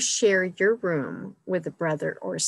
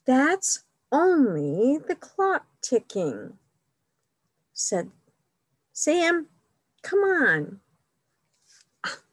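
put-on lively read-aloud for children, higher and louder; story reading; 6 semitones higher, 6 dB louder than usual. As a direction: read it like a picture book to kids. Reads picture book aloud.

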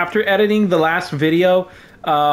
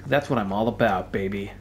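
mock cool; 8 semitones lower, 6 dB quieter than usual. Choosing the darker path.

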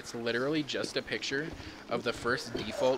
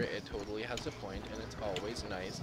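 dry sarcastic denial; 3 dB louder than usual. Acted. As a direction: say it deadpan, meaning the opposite. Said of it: "It's not annoying".

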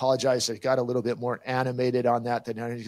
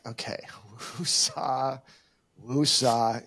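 self-calming breath; slower than usual. Retold or imitated. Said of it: "And I'm like".

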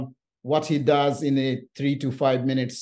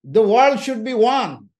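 rally-cry demand for an open world; retold shout; 6 semitones higher, 5 dB louder than usual. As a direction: proclaim it like you're yelling at the queue. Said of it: "I was shouting at them".

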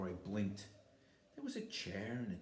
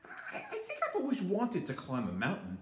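delighted bear; 8 semitones higher, 4 dB louder than usual. A narrator's reading.

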